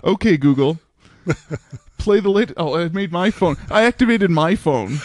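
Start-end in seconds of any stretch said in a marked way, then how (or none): 0.0-2.5 commanding smart speaker. Pretend. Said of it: "trying to do a trick on you guys".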